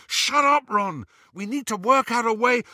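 high, loud urging; urgent insistence. A narrator's reading. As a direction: urge him over the yelling. Harry talking over Ron's yelling.

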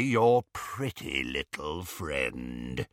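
low, slow, savouring rasp; menacing relish. A narrator's reading. Werewolf turning to Hermione, creepy relish. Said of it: "The relish in his voice".

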